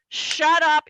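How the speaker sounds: sharp loud snap; angry dad. Retold or imitated. Dad snapping at the heckler, loud. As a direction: bark it at the stoner.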